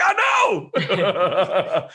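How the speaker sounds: high, loud laughing shout; excited shout. Retold or imitated. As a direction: shout it gleefully. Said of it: "I'm like".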